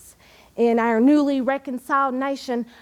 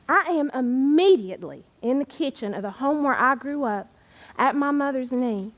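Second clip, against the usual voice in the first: normal talking voice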